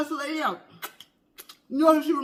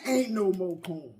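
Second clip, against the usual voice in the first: lower, softer deadpan quote; a hint of the old lady's refusal; cornbread lady; 8 semitones lower, 6 dB quieter than usual. Repeating the cornbread lady line, low and quiet. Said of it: "Did she tell Martin that?".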